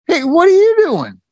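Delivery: quoting the doctor catching him off guard; surprised doctor. Retold or imitated. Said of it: "and he goes".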